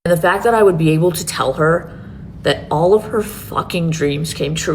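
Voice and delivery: normal talking voice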